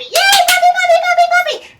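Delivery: very high, loud, excited puppy talk; squeaky happy voice. Shown as a demonstration. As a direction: gush at the dog like a thrilled child. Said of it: "raised my voice and used a squeaky happy".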